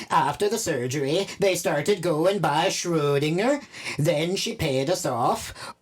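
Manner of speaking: usual speaking voice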